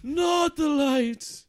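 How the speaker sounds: slow loud shouted warning; angry shout. Acted. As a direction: bellow it slowly, furious.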